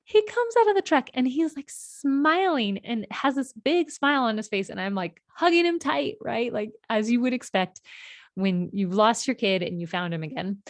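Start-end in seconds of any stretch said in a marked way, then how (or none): none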